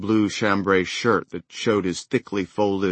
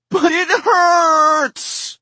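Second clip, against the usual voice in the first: put-on high, loud, stretched-out child's wail; 9 dB louder, much slower than usual. Ellie wailing at her father about her knee, drawn-out child's wail. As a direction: wail it like a hurt little girl.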